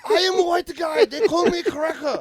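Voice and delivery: loud, high mock Chinese accent. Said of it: "you're doing it again".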